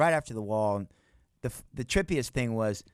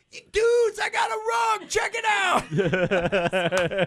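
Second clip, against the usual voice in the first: very high, loud shouted boast; excited shout; 4 dB louder, much slower than usual. Retold or imitated. Showing bandmates the rug on the jet, shouting. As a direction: yell it proudly to the whole plane.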